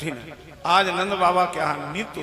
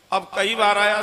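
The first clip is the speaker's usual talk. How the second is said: ordinary delivery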